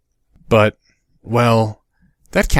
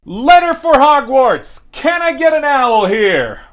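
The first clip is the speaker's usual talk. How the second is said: high, slow, loud shout upward; 5 dB louder, slower than usual. A narrator's reading. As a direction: shout it at the empty sky. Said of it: "shouted into the empty sky".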